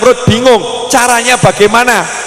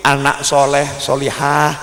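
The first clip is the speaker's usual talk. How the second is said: lower, soft and slow bedtime coaxing; gentle coaxing; 7 semitones lower, 4 dB quieter than usual. Shown as a demonstration. Parent coaxing a child to bed, soft and slow. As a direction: coax a child to sleep tenderly. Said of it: "ngomongnya pelan-pelan".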